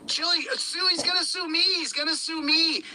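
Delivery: high, whiny mimic of tough talk; mock them as all bluster; mocking whine. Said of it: "Everybody said".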